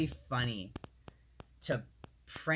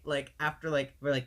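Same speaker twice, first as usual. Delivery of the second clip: usual speaking voice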